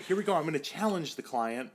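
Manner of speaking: a trainee hyping up the next move; nervous self-talk. Retold or imitated. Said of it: "internal dialogue going on".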